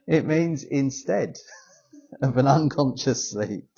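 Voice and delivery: real laughter breaking into the words; laughing. Real, not acted. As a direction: recover from a slip through giggles. Laughing while correcting her slip.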